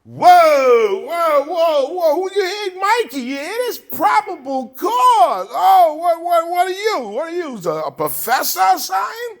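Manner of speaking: high, loud mocking cop yelling whoa; mock cop outburst. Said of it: "He's like".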